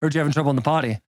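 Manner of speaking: low, quick, cheerless delivery; joyless Santa. Retold or imitated. Will as Santa asking a child about potty trouble, low and joyless. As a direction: imitate a Santa with no happiness in the voice. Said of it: "No happiness in his voice".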